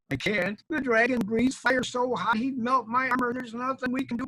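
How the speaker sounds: high, fretful excuse-making; timid storybook knight, worried; cowardly knight. Acted. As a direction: whine out excuses in a high put-on voice.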